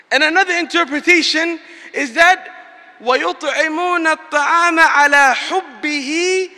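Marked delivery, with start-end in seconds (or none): 3.0-6.5 rapid chanted Quran verse; quick recitation. Acted. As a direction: recite the verse swiftly in Arabic.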